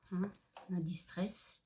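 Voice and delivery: soft unvoiced aside; whispering. Real, not acted. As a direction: whisper the brand name. Aparté chuchoté sur l'encre. (chuchoté)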